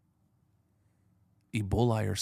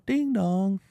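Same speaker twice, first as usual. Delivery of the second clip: ordinary talk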